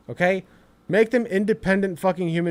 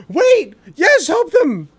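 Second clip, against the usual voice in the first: retold excited yell; 7 semitones higher, 6 dB louder, much slower than usual. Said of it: "I'm like".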